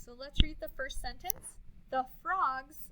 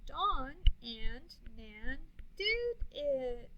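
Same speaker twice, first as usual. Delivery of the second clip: slow choral reading; much slower than usual. Shown as a demonstration. Choral reading, stretched slowly.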